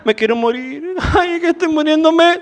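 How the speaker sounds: high, hammed-up moaning with a laugh, mock dying; sick person who gives up, a mock high moaning whine; whining invalid.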